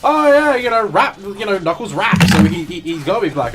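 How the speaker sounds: high, loud mocking of a dumb joke; mock joker voice. Retelling people's Knuckles jokes, hammed-up high mocking voice. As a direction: ham up the joker's logic. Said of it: "people were making the jokes".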